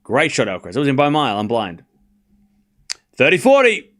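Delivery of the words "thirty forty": loud, high-pitched score call; excited score call. Real, not acted. Calling the score.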